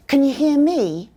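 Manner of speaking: "Can" reduced to kun and linked to "you"; reduced "Can" running into "you"; weak can in a question. Shown as a demonstration.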